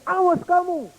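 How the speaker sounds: put-on high child's threat; angry threat. Furious little boy threatening the echo, high and sharp. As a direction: warn the voice off.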